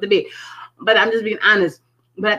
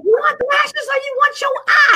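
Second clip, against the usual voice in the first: high, loud exasperated sister; scolding sister; 4 dB louder than usual. Retold or imitated. Sister scolds her for choosing lashes over her eye. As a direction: bark it like a fed-up sister.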